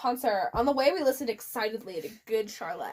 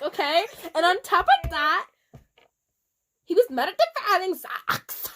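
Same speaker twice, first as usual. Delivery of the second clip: defensive rant; 5 semitones higher, 3 dB louder than usual. Acted. Author's note rant.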